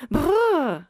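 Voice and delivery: brrr aigu et fort; frisson brrr. A narrator's reading. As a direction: grelotter un brrr sonore. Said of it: "fit Mouscaillot". (un brrr de frisson, aigu)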